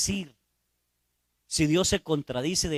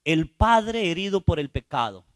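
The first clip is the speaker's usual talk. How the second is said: usual speaking voice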